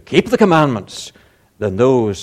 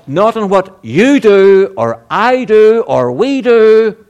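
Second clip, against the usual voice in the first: raised pitch, raised voice, pressing the point; emphatic preaching; 8 semitones higher, 6 dB louder, slower than usual. Acted. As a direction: preach it home to the congregation.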